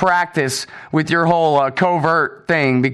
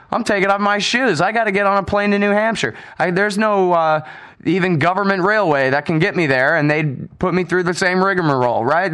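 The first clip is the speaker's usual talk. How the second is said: usual speaking voice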